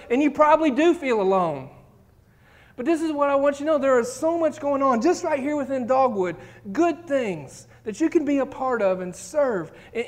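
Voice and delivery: rally the church; fervent preaching. Real, not acted. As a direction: pastor urging the congregation, full voice. Preaching at full force.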